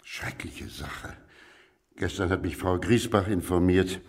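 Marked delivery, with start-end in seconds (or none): 0.0-1.1 whisper. Acted. Colleague grieving over the death.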